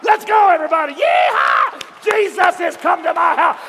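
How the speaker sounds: loud, high whooping cry; rally everyone with wild joy; jubilant shout. Acted. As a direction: Zacchaeus rallying the crowd, shouting. Zacchaeus rallies crowd.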